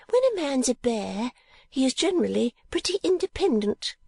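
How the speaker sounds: high-voiced chattering portrait painter; Miss La Creevy. A narrator's reading. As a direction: lift into a high, prim, gossipy voice.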